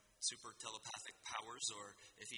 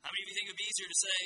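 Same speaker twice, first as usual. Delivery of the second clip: 1 quick, rushed question; 7 semitones higher, 7 dB louder, much faster than usual. Real, not acted. Quizzing the crowd for hands.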